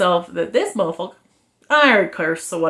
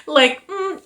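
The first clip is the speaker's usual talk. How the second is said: usual speaking voice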